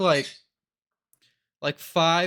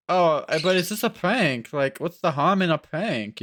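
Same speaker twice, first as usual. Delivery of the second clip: higher, louder mock-innocent objection; clueless prank defender; 6 semitones higher, 5 dB louder than usual. Imagined prankster defending himself to critics, mock innocent. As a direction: act out someone protesting they meant no harm. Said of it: "see someone being like".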